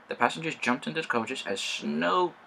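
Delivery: usual speaking voice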